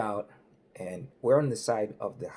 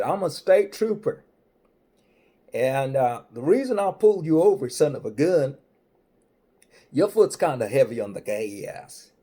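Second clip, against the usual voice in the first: hammed-up highway patrolman; state trooper voice; 3 semitones higher, 8 dB louder than usual. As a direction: play the big-voiced cop laying down the law. Comic retells state trooper stop.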